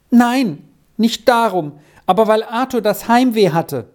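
put-on high, eager child's voice; child Squirrel, earnest. A narrator's reading. Squirrel explains herself to the professor, earnest child.

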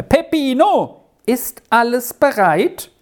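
high, ringing call from afar. A narrator's reading. Lady calls to her donkey boy, impatient high call. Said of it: "Jetzt tönte Helminas Stimme".

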